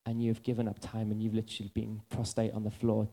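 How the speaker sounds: gentle voice of God. Retold or imitated. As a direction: speak as God correcting him kindly. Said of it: "He said this to me".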